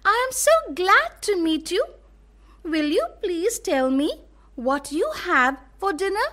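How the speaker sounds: high, quick, delighted little voice; an eager young elephant, glad and polite; happy little elephant. Acted. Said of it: "happily and said".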